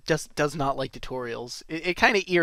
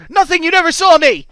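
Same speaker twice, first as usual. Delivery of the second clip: loud, high, blurted shout; hammed-up guilty outburst; panicked denial; 13 semitones higher, 13 dB louder than usual. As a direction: yell it like a kid caught sneaking out. Caught sneaking out, blurts denial.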